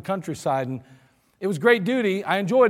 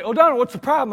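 higher, louder exasperated demand; impatient officer; 4 semitones higher, 7 dB louder, faster than usual. Retold or imitated. Lieutenant scolding his lost driver, loud and impatient. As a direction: snap at your driver. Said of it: "he'd say".

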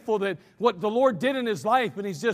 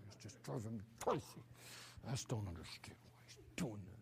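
low hushed complaining mutter; grumbling murmur; 11 semitones lower, 19 dB quieter than usual. Shown as a demonstration. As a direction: grumble it half under your breath. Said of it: "Can't you hear that?".

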